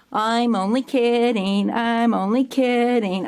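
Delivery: put-on kid admitting a prank. Prankster kid to the frightened director, drawn-out teasing. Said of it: "then he goes".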